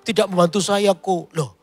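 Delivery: khotbah bersemangat, suara lebih tinggi; berkhotbah penuh semangat. Acted. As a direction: dorong jemaat dengan tegas dan bersemangat. Preaching with rising force. (pendeta menasihati jemaat soal pernikahan dengan semangat)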